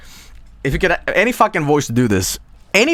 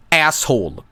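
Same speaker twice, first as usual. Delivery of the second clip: asshole voice. Shown as a demonstration. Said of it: "without saying, like".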